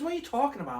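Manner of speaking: high-pitched retold bewilderment; imitating bafflement. Companion answering a confused viewer, imitated bafflement. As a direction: imitate a companion who is lost.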